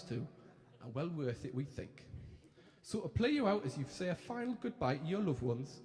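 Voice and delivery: lightly put-on Geordie TV presenters; chirpy Geordie telly hosts; Geordie accent. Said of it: "Apologies for the accent now".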